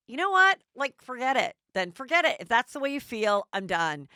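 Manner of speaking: loud, high mock anger; huffy outburst. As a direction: storm off in a huff. Victoria angrily storming off.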